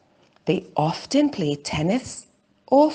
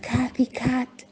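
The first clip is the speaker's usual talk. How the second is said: hushed goodnight to a sleeping cat; whispered good night; 6 semitones higher than usual. A narrator's reading. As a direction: whisper her name like she's asleep. Bedtime goodbye to the cat.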